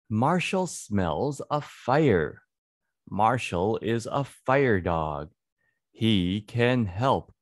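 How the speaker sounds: ordinary talk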